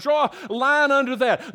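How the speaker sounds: ordinary talk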